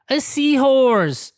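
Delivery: finally he found it; excited cry. Acted. Pete finally finds the seahorse, excited cry. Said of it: "cries Pete".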